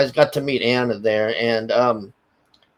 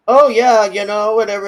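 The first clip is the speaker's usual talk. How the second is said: dismissive brush-off; 6 semitones higher, 7 dB louder than usual. Retold or imitated. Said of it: "she's just like".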